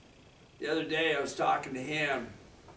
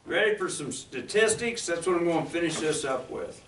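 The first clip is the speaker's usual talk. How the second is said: high, loud preacher energy; rally the congregation loudly; fired-up preaching; 5 semitones higher, 9 dB louder than usual. Acted. Preaching at full force.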